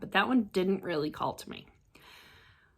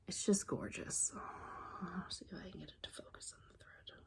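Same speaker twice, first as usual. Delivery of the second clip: hushed, admiring the fabric; awed whisper; 15 dB quieter than usual. Real, not acted. Crafter showing fabric to viewers, whispering.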